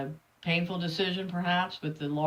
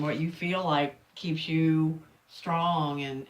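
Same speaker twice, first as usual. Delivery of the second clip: ordinary talk, nothing marked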